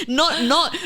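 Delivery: normal talking voice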